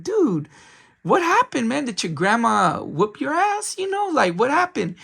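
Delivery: high-pitched imitation of asking a friend; retold eager questioning. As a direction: pitch it up, pressing for the story.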